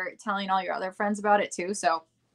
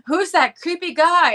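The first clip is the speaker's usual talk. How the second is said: suspicious moviegoer; 8 semitones higher, 7 dB louder than usual. Acted. Voicing viewers of a horror movie.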